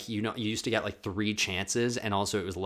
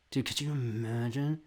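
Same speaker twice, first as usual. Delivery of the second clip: usual speaking voice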